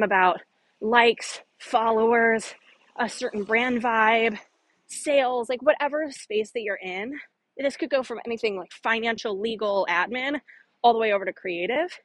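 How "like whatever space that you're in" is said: ordinary delivery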